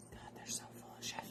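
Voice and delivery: barely audible, quick whisper; hushed whispered grumble; whispered aside. Real, not acted. Aside about her cats.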